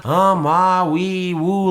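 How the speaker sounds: drifting silly woo noises; a loose hammed-up surfing yelp; mock surfer woo. Shown as a demonstration. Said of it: "like we're surfing in the fucking ocean".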